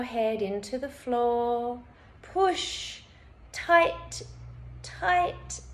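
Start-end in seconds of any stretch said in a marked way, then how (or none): 2.2-5.6 high, effortful one-word commands; urging to tense. Shown as a demonstration. Instructor urging listeners to push fists down, urging to tense.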